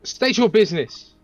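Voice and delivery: loud commanding call; shouting. Acted. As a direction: bark the challenge across the field. Calling out to approaching soldiers.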